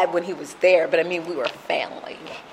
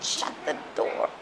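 imitating dismissive dad; 7 dB quieter than usual. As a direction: mimic a father callously sending kids away. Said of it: "And he said".

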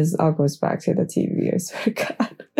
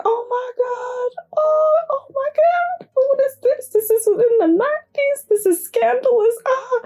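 giddy fangirl squeal. Retold or imitated. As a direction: squeal like a fan who can't handle the scene. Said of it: "squealing with these ladies".

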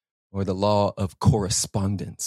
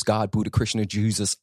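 normal talking voice

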